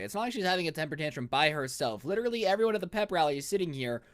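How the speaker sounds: usual speaking voice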